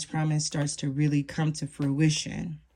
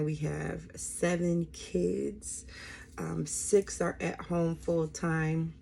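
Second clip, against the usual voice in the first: ordinary delivery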